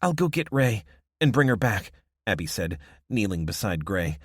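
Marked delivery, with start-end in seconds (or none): 0.0-1.9 urgent woman voice. Acted. Abby speaks.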